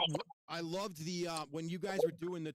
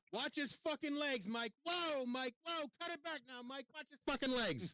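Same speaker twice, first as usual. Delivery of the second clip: higher, slow mock panic; 7 semitones higher than usual. Fan acts out the panicked warnings to Mike in a higher voice. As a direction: call out warnings as the panicked guy.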